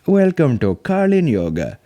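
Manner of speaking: slow, grand welcome in a put-on Indian accent; mock Indian accent. Imagining owning a yoga brand, hammy Indian guru accent. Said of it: "imagine I owned a thing and I was like".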